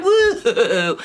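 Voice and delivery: high, loud bouncing whoop; big playful woo. Acted. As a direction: whoop as you sink down. One more try on the bed.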